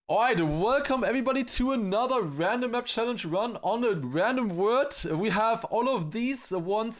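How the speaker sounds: high, bright hyped announcing; YouTuber intro voice. Acted. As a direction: pump up the viewers. Retakes stream intro in announcer voice.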